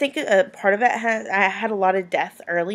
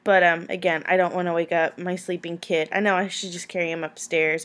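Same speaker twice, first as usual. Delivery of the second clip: normal talking voice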